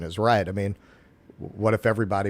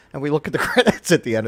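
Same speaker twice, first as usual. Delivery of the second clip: ordinary talk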